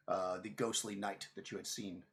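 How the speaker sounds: normal talking voice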